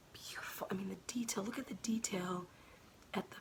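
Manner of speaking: hushed admiring whisper. Real, not acted. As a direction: whisper in awe at the detail. Admiring delicate buttons up close.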